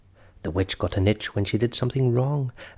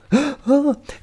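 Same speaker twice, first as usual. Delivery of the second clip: sharp high gasp; startled high gasp; 12 semitones higher, 5 dB louder than usual. A narrator's reading. The wizard faces the dinosaur, startled gasp. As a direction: gasp in fright.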